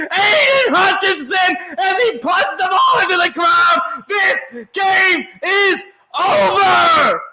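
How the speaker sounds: ecstatic shout. Real, not acted. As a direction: let it all out as the game ends. Game-winning play called live.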